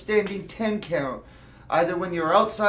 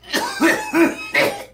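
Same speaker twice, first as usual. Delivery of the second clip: coughing; 11 semitones higher, 4 dB louder than usual. Real, not acted. Coughing after a bong hit.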